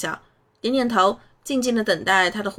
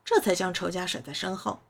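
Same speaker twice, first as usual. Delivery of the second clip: ordinary delivery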